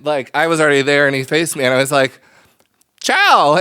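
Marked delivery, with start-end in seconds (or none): 3.0-3.5 awkward sing-song greeting. Retold or imitated.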